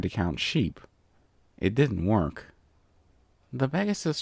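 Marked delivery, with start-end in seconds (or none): none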